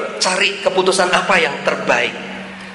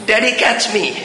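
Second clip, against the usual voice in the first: high-pitched mock kid's call to dad; playful high-voiced imitation of a son calling; grown son calling childishly; 7 semitones higher, slower than usual. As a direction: mimic the little boy's call, pitched up. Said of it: "dia juga ngomong begitu".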